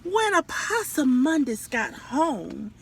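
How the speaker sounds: ordinary delivery, nothing marked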